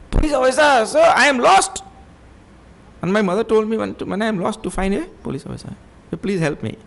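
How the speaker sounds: put-on high, pleading child's voice; high childlike plea for help; lost little boy pleading.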